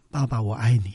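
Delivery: tender retold confession. Daughter phoning her father to say she loves him, quiet and tender. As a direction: say it low and gently, as remembered. Retelling first confession of love.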